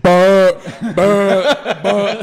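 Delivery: loud, drawn-out repeated buzzer noise; high, stretched mock siren blasts; imitated alarm. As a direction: blare like a fire alarm, between laughs. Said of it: "an alarm like this".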